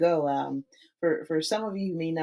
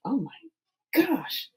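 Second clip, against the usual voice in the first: admiring gasp; 8 semitones higher, much slower than usual. Retold or imitated. Mirror self-talk admiring oneself.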